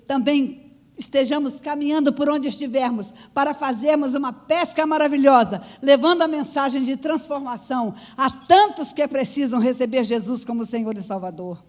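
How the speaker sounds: pregação enfática. Real, not acted. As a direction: proclaim it to the congregation, hitting key words. Prega à congregação com força. (pregação enfática, em voz firme e forte)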